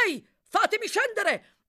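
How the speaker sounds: high, loud, urgent demand; shouting. A narrator's reading. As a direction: yell to be let out at once. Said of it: "gridò il medico". (grido impaziente)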